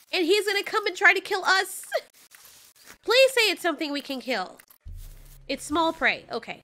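ordinary talk

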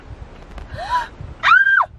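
startled high gasp; sharp gasp. Real, not acted. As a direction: gasp in sudden fright. Spider found in car.

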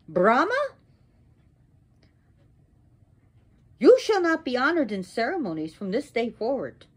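high, declamatory pronouncement; wrathful curse. Acted. Sarasvati curses Brahma before the assembly, wrathful proclamation. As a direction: proclaim it forcefully, pitch swooping up.

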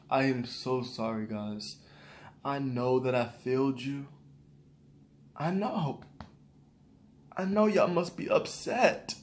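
low, very soft, sheepish apology; mock apology. YouTuber fake-apologizing to disappointed viewers, hushed mock apology. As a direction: put on a hushed, contrite apology.

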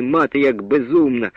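plain reading voice

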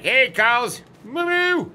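high, loud call across the field; a high, loud hey cows, then a moo-moo call. Real, not acted. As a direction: hail the herd cheerfully. Calling out to the cows.

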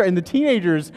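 usual speaking voice

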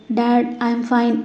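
soft, faint murmur from sleep; sleep-talking murmur. A narrator's reading. Ashvi feverish in bed, murmuring to her dad in sleep. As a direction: mumble it half asleep. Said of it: "నిద్రలోనే కలవరించడం".

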